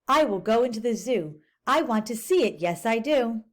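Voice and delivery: lively picture-book read-aloud; teacher reading a storybook aloud to kids; reading to children. Acted. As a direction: read it to the class, bright and clear.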